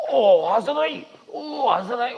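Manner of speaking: high, loud mock cry of "ओ, हंस रही"; hammed-up tattling gasp; mock scandalized gossip. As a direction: mimic a shocked busybody. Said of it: "हंस लेंगे तो".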